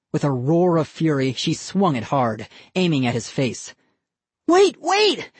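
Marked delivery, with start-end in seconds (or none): 4.5-5.3 much higher, louder panicked cry. A narrator's reading. Ian pleads with his sister as she swings, panicked cry. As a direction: dodge the swinging doll. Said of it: "he cried".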